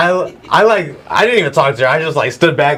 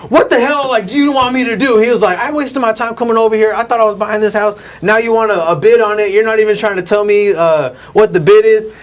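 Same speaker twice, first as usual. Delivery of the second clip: retold high-pitched exasperated rant; frustrated buyer; 8 semitones higher than usual. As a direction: imitate an indignant customer complaining, pitched well up. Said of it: "he gets frustrated with me".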